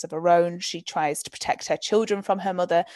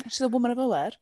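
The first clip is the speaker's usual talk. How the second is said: mock admiring voice; 5 semitones higher, faster than usual. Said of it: "I was like".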